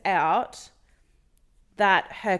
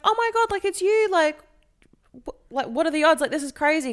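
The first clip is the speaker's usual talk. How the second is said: high, gushing, delighted surprise; excited girlfriend; 9 semitones higher, 4 dB louder, faster than usual. Retold or imitated. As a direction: squeal it like spotting your boyfriend across a bar. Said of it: "She's like".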